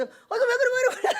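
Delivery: high, flustered comic yelp; startled. Retold or imitated. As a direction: act taken aback, voice shooting up. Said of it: "소리를 지르는 거에 놀래갖고".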